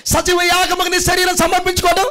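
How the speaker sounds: rising, high, loud preaching build; full-force preaching. Acted. Pastor rousing the congregation, building to a shouting preach.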